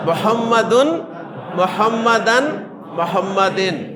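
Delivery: محمدن said three times, ending un, an, en; three case endings. Shown as a demonstration. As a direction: mark each ending of محمدن distinctly. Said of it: "تینوں forms بول دیں گے". (محمدن کی تینوں اعرابی صورتیں واضح)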